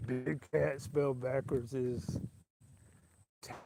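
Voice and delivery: very slow, lightly voiced announcer drawl; a hint of Harry Carey, slowed right down; Harry Carey impression. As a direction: savour each word like a ballpark announcer. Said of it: "How would Harry Carey say whose name?".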